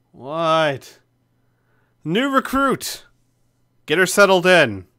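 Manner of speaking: loud, groggy, just woken. Acted. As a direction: shout it half asleep. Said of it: "very groggily".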